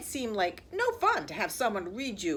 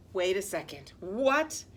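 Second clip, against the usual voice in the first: ordinary delivery, nothing marked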